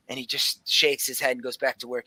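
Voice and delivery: ordinary talk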